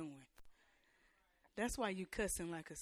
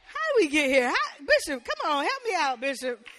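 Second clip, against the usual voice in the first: loud, high preacher's plea; shouting across the church for help; preaching at full force; 6 semitones higher, 13 dB louder than usual. Acted. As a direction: call out to the Bishop for backup. Calls on the Bishop.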